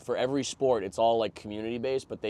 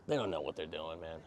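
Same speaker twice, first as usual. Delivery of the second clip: dismissive echo; 7 semitones lower, 10 dB quieter than usual. Acted. Echoing the dismissive dad.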